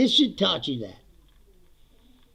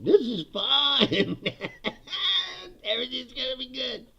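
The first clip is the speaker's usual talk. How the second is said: mock calm denial; 6 semitones higher than usual. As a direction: pretend everything is fine, breaking into a laugh. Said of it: "watching a nuclear mushroom cloud ... and going".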